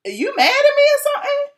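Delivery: high-pitched retold question; light, high mock-innocent query. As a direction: ask it way up high, all innocence. Retells her innocent question.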